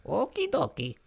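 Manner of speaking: put-on high Italian old man voice; squeaky Italian Luigi. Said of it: "That voice is perfect".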